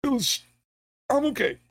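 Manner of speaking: high, strained voice like he is still choking; high strained voice after choking; high choked friend. Retold or imitated. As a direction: squeak it out like he is barely recovering. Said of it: "And he was like".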